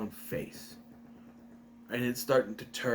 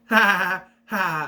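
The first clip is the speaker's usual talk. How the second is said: loud, high mock villain cackle; evil laugh; 6 semitones higher, 8 dB louder than usual. The masked brother laughing from the shadows, evil cackle. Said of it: "And then you hear".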